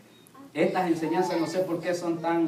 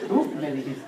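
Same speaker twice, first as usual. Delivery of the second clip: blunt retort; 4 semitones lower than usual. Retold or imitated. Young son answering his father back, blunt imitated retort. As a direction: deliver it as a child's direct, deadpan accusation. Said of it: "Esteban es directo".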